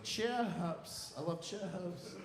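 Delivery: high, soft, drawn-out words; childlike pleading. Acted. Eater defending chips to nutritionist, high soft plea. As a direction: plead softly and slowly in a high voice. Answering the nutritionist about favourite food.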